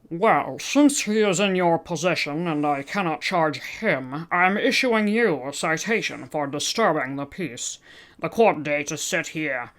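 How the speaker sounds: pinched nasal cop, matter-of-fact; police officer questioning in a nasal voice. Acted. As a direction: read it like a bored officer through the nose. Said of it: "I'm only doing nasal voices".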